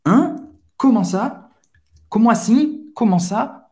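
high, rising questions of surprise; surprised. Shown as a demonstration. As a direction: react in disbelief, pitch jumping up. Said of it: "expressar uma, uma surpresa". (surpreso, perguntando em tom agudo)

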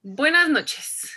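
high, drawn-out pageant greeting; mock beauty-queen greeting, stretched out slowly; Miss Universe contestant. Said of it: "me siento en Miss Universo yo contestando".

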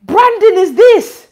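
high, loud incredulous cry; shocked. Retold or imitated. Pastor reacting to the video, high incredulous outburst. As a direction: ask it in high disbelief. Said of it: "I said".

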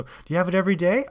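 feigned amazement, egging someone on; egging her on. Retold or imitated. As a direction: imitate a guy eagerly prompting. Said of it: "egging her on".

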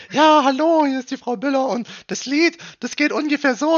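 mock caller in a very high, loud voice; eager listener phoning the radio, voiced very high and loud; caller woman. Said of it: "Und meinte".

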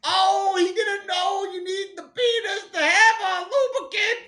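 high, loud, drawn-out comic impression; Bill Cosby. Host doing Bill Cosby for the co-host, hammed-up impression. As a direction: wail it slowly and loudly in a caricatured voice. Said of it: "imagine Bill Cosby saying that sentence".

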